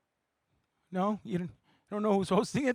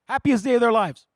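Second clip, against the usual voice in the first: ordinary talk, nothing marked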